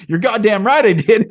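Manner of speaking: laughing loud high defiant shout; defiant yell. Retold or imitated. Adam answering God, laughing defiant loud shout. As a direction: blurt it out with a laugh, cocky. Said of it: "instead of him saying".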